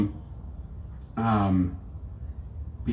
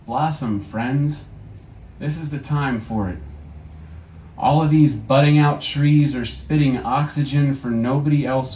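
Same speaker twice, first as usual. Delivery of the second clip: poem reading; 6 semitones higher, 4 dB louder, slower than usual. Acted. Poet to audience, a fervent then tender reading. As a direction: urge the listeners gently on.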